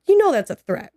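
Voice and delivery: high, loud incredulous warning; imitating a disbelieving cop; retold challenge. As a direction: call him out, pitch jumping up. Retold cops challenging the dad.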